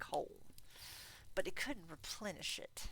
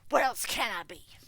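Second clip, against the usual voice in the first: loud, bitter tirade; ranting miser; 3 semitones higher, 13 dB louder than usual. Acted. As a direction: rail against the season. Scrooge ranting.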